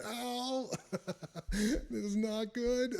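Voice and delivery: high, slow ow with laughter in it; laughing through pain. Retold or imitated. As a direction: laugh while wincing at the hurt. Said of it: "and then laughing".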